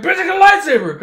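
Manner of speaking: high, loud yelled brag; Han's victory yell; triumphant shout. Acted. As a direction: yell it like a war cry. Han echoing the lightsaber brag.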